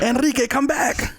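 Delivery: high, slow soap opera plea; telenovela drama. Pretend. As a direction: beg like a telenovela heroine. Mocking Spanish telenovela dramas.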